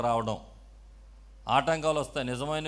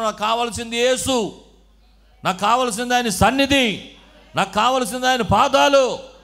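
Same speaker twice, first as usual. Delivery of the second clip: loud, high-pitched impassioned sermon delivery; full-force preaching; 7 semitones higher, 10 dB louder than usual. Real, not acted. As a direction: preach it out urgently, voice raised throughout.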